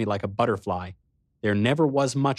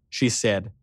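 ordinary delivery, nothing marked

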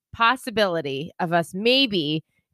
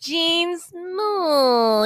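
higher-pitched, drawn-out self-talk; dismissive refusal of jeans; 8 semitones higher than usual. Acted. Talking herself out of wearing jeans, dismissive. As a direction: say it high, refusing your own jeans. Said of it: "or you're like".

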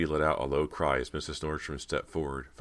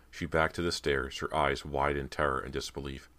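usual speaking voice

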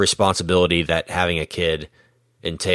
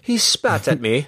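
light mock British accent, higher; 7 semitones higher than usual. Playing a Brit saying spat to the co-hosts, light mock British accent. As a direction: say it like a prim Brit. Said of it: "a very British word".

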